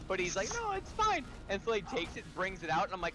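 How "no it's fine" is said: very high mock reassurance; breezy insistence. Clerk dismissing buyer's protest on the way out, breezy insistence. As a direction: imitate the helper brushing it off, pitched way up.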